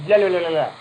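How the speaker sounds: imitating a friend speaking Spanish; mock Spanish accent. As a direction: rattle it off like a fast Spanish speaker. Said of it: "she sounds very Spanish".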